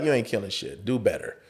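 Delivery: dismissive put-down. Retold or imitated. Director deflating a cocky young actor, low and dismissive. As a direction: drop it low and unimpressed.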